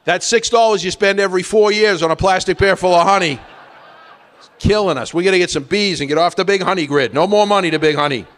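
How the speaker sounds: high, loud hammed-up yelling at the wife; mock screaming rant. Neighbor yelling at his wife over honey bills, hammed-up loud rant. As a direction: rant about honey money like a furious cheapskate. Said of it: "screaming at my wife saying".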